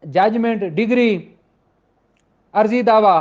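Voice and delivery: ordinary talk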